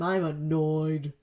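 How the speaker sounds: slow, deliberate "I'm annoyed."; slow admission. Real, not acted. Confessing to listeners she recorded on the wrong mic side, slow and held in. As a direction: say "I'm annoyed." slowly, holding it back. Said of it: "Honesty time".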